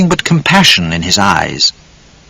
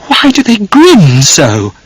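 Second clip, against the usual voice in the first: hysterical thought; 8 semitones higher, slower than usual. A narrator's reading. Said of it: "Charlotte thought hysterically".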